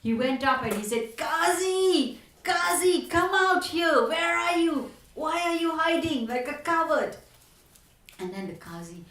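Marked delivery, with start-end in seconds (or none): 1.2-7.2 high, loud challenging call; shouting at the hiding ruler to come out. Retold or imitated. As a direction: summon him out, daring and scornful. Chaitanya calls out the hiding Qazi.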